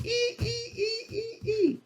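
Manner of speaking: very high, thin monkey squeal; monkey squeaks. A narrator's reading. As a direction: squeak like a teasing little monkey.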